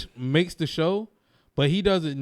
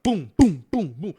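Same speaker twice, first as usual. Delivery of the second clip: rapid-fire booms; 2 semitones higher, 5 dB louder, slower than usual. Retold or imitated. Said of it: "You had no time to, like, breathe".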